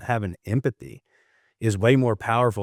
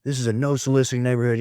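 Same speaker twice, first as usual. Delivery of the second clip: imitating angry homeowner; 4 semitones higher than usual. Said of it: "just dog cussing him".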